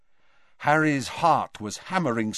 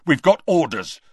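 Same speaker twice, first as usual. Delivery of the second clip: louder, higher, sharp reminder; 4 semitones higher, 6 dB louder than usual. A narrator's reading. Death Eater reminds the others of orders, sharply. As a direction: say it sharply, as a superior would. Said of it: "said the fourth Death Eater sharply".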